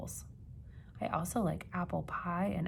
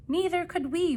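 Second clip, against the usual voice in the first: eager reply; 11 semitones higher, 7 dB louder than usual. Acted. Excited kids answering grandparents.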